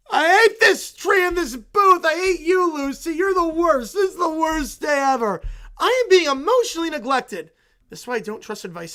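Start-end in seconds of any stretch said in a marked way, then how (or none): 0.1-7.5 loud, higher angry shouting; furious kid yell. Acted. Kid screams at Lucy about the tree and booth, furious. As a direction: blow up at Lucy.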